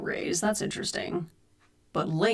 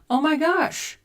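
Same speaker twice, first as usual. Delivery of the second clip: gasp, then high thrilled oh my gosh; excited gasp; 9 semitones higher, 4 dB louder than usual. Real, not acted. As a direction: catch your breath in delight. Reacting to chat news.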